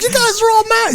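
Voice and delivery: very high, cracking mock outrage; squeaky outrage. Mocking the angry man in the mask scene, high squeak. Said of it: "He's like".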